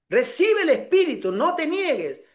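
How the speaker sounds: high, commanding mimicked faith healer; insistent preacher. Pastor insisting to a reluctant newcomer, high imitated command. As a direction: press the blessing on a reluctant listener.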